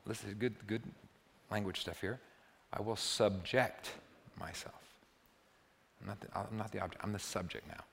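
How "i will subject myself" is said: hushed, deliberate praying; contemplative prayer. Acted. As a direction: let it sink almost to nothing. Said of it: "a contemplative prayer".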